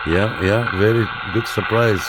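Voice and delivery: usual speaking voice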